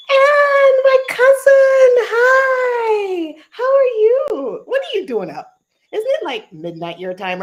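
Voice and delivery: high, loud delighted squeal; excited greeting. Real, not acted. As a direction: greet your cousin with a squeal of joy. Delighted greeting to her cousin.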